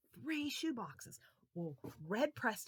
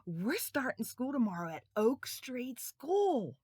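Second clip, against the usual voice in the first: put-on higher, proud child answer; eager boys; 6 semitones higher, 9 dB louder than usual. Boys telling the lady their school, eager. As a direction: answer brightly. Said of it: "boys answer".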